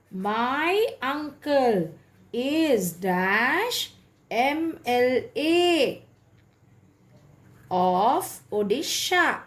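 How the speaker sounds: very slow, word by word; slow dictation. Shown as a demonstration. Teacher dictating an example sentence to students, very slowly. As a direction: spell it out for them to write down.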